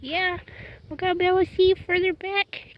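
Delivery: high coaxing. Real, not acted. Coaxing horse.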